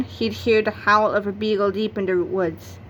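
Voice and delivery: plain reading voice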